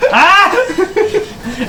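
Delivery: very high, comic "eh?" cry; hard-of-hearing old gnome. Acted. Old gnome with a listening cone, squawking for a repeat. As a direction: squawk it like you can't hear. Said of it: "a listening cone".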